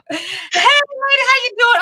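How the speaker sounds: high, slow, drawn-out hello; excited welcome. Real, not acted. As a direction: greet a dear friend with delight. Welcomes guest on stream.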